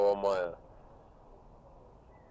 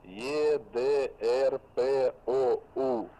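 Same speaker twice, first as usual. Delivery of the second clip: very slow letter-by-letter spelling; dictating letters; 2 semitones higher, much slower than usual. Real, not acted. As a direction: spell each letter slowly. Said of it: "Повторяю".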